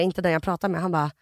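usual speaking voice

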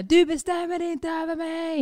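high, slow defiant shout; mock toddler yelling at the preschool teacher; defiant child yell. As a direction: scream it at the teacher like a defiant kid. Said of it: "hon skriker till pedagogerna".

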